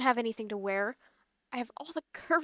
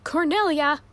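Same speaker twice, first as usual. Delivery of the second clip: usual speaking voice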